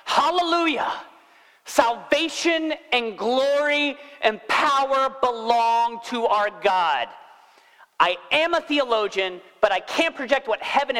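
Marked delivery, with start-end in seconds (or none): 0.0-7.1 high, slow proclamation of praise; shouting multitude. Acted. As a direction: proclaim it like a heavenly crowd, drawn out. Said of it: "a great multitude in heaven shouting".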